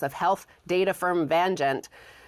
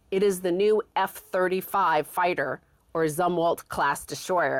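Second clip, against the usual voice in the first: ordinary talk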